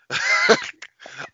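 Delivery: very high burst of laughter; shrieking laugh. Real, not acted. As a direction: let out a squealing laugh. Laughing at twenty-five wins.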